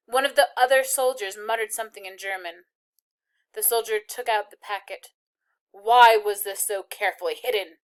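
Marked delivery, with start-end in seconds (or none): 5.7-7.8 loud sharp demand; snapped. A narrator's reading. Soldier confronting the girl, snapping. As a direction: snap the question at her. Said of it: "he snapped".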